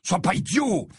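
heated, high command; urgent rebuke. A narrator's reading. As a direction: tell your friend off sharply. Harry restrains Ron mid-fight.

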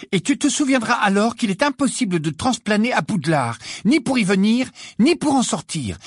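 high-pitched, exasperated lecture. A narrator's reading. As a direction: spell it out at the end of your patience, voice high. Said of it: "au comble de l'exaspération". (au comble de l'exaspération, voix aiguë)

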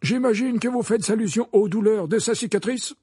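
high, loud icy challenge. A narrator's reading. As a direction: cut in coldly and forcefully. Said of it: "dit Dumbledore d'un ton glacial".

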